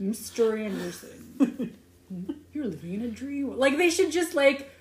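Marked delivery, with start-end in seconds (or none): 0.0-3.6 smoldery villain voice. Retold or imitated. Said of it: "like, a smoldery voice off".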